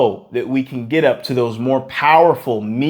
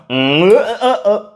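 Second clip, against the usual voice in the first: strained uh uh with higher cracks; cracking, straining voice; 8 semitones higher than usual. Shown as a demonstration. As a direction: hum that breaks and cracks upward. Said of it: "that's where you get the breaks, the cracks, the strains".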